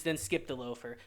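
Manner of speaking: ordinary talk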